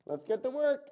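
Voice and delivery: loud, muffled behind a face mask; muffled through respirator. Real, not acted. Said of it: "in my respirator".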